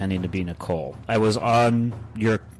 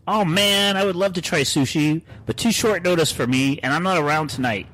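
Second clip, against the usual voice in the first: high-pitched, put-on letdown; mock disappointment; 8 semitones higher, 3 dB louder than usual. Joey turning down sushi on short notice, mock disappointed. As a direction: whine it a little, playfully let down. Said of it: "Then he puts a sad face".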